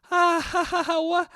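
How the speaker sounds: high, fake polite laugh; co-host laughing nervously at Biden, high; forced laugh. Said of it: "the woman he's talking to is like".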